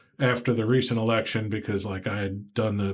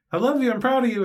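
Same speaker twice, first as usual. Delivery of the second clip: imitating mom; 13 semitones higher, 5 dB louder than usual. Mother voiced on the phone.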